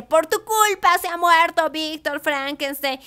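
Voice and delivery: slow, lifted blame at the creature; accusing Robert. Retold or imitated.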